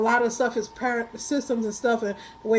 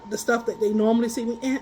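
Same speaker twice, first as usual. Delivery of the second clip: ordinary talk, nothing marked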